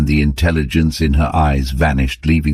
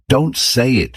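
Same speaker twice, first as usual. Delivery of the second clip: mock scream; 10 semitones higher than usual. A narrator's reading. Wife stopping her husband's words, higher frightened cry. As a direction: cut him off in fear. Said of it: "she screamed".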